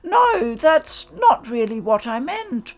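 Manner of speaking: high, loud, startled no; flustered denial. Acted. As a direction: blurt it out, caught off guard. Protagonist flustered under glare.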